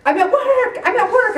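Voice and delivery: high, agitated repeat; frantic mutter. Retold or imitated. Stuck at work after the news, agitated and high. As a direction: say it like you're holding in fury. Fuming at desk.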